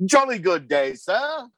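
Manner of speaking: high, jolly olde English gentleman; Old English voice. Pretend. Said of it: "I'll speak in Old English the entire time".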